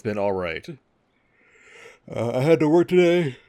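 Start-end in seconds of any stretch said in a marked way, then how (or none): 2.1-3.4 real yawn pushing through the words. Real, not acted. Streamer telling viewers about the work day, yawning. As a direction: talk through a yawn, higher and louder. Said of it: "Why am I yawning?".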